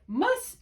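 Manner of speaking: "mus-" punched, cut off before the ending; "mus-" said strong, as in MUS-terd; stressed first syllable. Shown as a demonstration. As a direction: hit "mus-" as the accented syllable. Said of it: "which one's accented".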